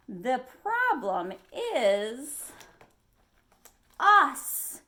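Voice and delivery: slow suspense then a loud burst; dramatic reveal. A narrator's reading. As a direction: land the last word like a shock.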